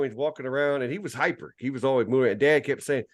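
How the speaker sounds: usual speaking voice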